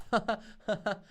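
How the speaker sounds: high giggle; short burst of high laughter; real laugh. Real, not acted. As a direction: crack up at a silly joke. Laughs at own joke.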